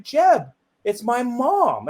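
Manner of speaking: high, slow mock-tearful plea; imitated tearful mom. Crying mom protesting to her child, mock-tearful. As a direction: plead as a crying mother, lightly acted. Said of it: "my mom started crying instantly".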